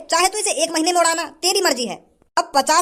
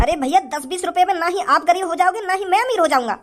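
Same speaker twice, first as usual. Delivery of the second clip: usual speaking voice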